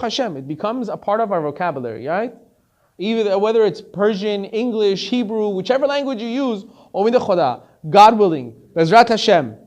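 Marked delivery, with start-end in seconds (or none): 3.0-9.6 louder, ringing list of phrases; preaching. Acted. Preacher listing God willing in every language, loud. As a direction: call each phrase out to the hall.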